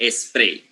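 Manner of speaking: "spray" with an E sound added in front, es-pray; Spanish-speaker epenthetic vowel. Shown as a demonstration. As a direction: put an extra "eh" before "spray", splitting it into two syllables. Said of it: "epenthetic vowel is a vowel that is inserted".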